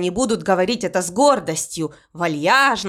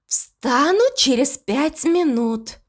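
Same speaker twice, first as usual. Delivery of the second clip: slow drowsy mumble; half-asleep, dragging the words out; sleepy voice; 4 semitones higher, slower than usual. Acted. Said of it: "сонным голосом отозвалась смерть".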